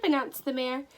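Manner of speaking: ordinary delivery, nothing marked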